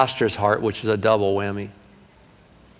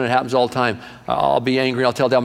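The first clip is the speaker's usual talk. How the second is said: ordinary talk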